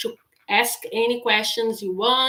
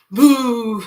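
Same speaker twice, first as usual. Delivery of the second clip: loud boo; startling boo; 2 semitones higher, 9 dB louder than usual. Shown as a demonstration. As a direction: shout boo to give a fright. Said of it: "And then".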